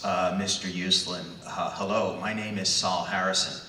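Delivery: low, dour male voice; Saul Harrison, dour. Acted. Publishing executive cold-calling a young man, dour. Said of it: "it's a dour male voice".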